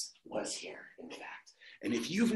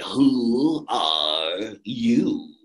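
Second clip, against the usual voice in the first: very slow, low, drawn-out voice; caterpillar voice; 6 semitones lower, 4 dB louder, much slower than usual. Acted. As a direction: stretch it out like a sleepy caterpillar. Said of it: "she hears a voice say".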